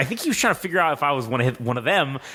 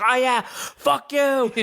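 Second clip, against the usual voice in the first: high, slow mock yell of "fuck you"; imitating a stranger yelling, high and slow; retold yell; 10 semitones higher, much slower than usual. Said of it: "he starts yelling that".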